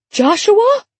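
high-pitched mother's shriek, slightly restrained; narrator's contained high shriek; shrieked call. A narrator's reading. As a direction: call out for a lost child, voice leaping up high. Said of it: "an equally loud voice shrieked from the other end".

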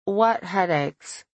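talking through a mouthful; slow, words round a bite of food; mouth full. A narrator's reading. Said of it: "Through a mouthful of food".

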